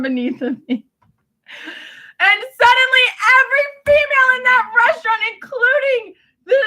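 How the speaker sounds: high, strained voice close to crying; tearful and overwrought. Real, not acted. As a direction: let the upset push the pitch way up. Tearful, worked-up memory of hostility.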